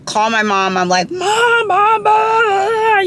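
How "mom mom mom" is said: high, loud, sobbing calls; calling mom on the phone while sobbing, high and loud; crying out for mom. Retold or imitated. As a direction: wail for mom through tears. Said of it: "I just start hysterically crying".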